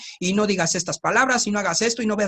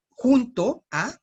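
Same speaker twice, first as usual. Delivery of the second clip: "junto a" spelled out slowly, rising on "junto"; teacher stretching out "junto a"; slow teaching stress; 4 semitones higher, much slower than usual. Shown as a demonstration. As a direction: lay out "junto a" slowly for the class. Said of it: "Uno que es para".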